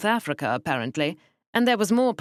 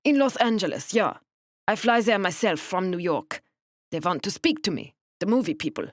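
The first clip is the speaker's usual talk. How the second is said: put-on German accent; 3 semitones higher than usual. German woman voice continues.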